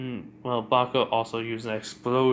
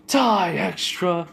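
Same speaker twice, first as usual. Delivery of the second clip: loud, high, drawn-out attack cry; battle yell; 7 semitones higher, 7 dB louder, much slower than usual. Retold or imitated. Bakugo launches an explosion at the girl, battle yell. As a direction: yell it slowly as the blast launches.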